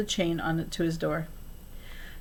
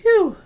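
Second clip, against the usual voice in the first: high, loud sigh of relief; mock relieved phew; 14 semitones higher, 8 dB louder than usual. A narrator's reading. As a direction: breathe out the relief. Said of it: "I looked down at my lucky jeans and thought".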